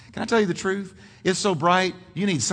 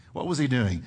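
usual speaking voice

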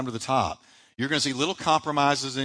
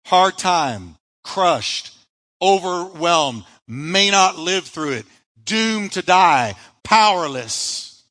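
each hardship weighed slowly, word by word; listing hardships; 2 semitones higher, 5 dB louder, much slower than usual. Shown as a demonstration. Pastor lists Paul's hardships, slow and heavy. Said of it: "listen to the next four words".

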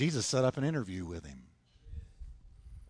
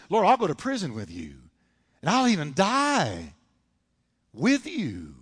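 bragging; 3 semitones higher, 4 dB louder than usual. Retold or imitated. Peter vowing loyalty to Jesus, bragging. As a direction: swell it into an overblown vow. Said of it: "look at how he bragged".

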